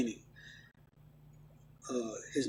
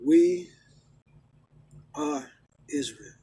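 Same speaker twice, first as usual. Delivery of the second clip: We, then are, then Israel, each split by long pauses; slow deliberate statement; slower than usual. Real, not acted. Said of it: "I'm gonna say it like this".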